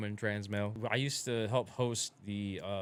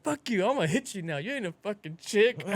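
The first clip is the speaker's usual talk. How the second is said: high, loud retold threat; mock fight challenge; 9 semitones higher, 4 dB louder than usual. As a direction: snap it like starting a fight. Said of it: "I was like".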